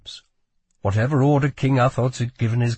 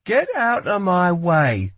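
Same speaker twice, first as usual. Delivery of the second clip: slow, higher, firm order; countering demand; 7 semitones higher, 4 dB louder, slower than usual. A narrator's reading. Lila counters the guard's order, firm demand. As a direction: push back word by word. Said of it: "countered Lila".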